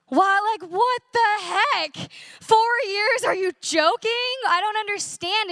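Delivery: high, incredulous outburst of disbelief; stunned disbelief. Retold or imitated. To her mom, learning she missed the warnings, in high incredulous disbelief. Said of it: "I remember saying to my mom".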